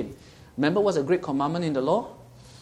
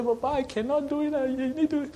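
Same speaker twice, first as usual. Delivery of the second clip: put-on much higher child's voice begging Papa; little child; 12 semitones higher than usual. Child calling Papa for help, high childlike plea. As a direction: beg Papa in a small child's voice. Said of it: "like a little child".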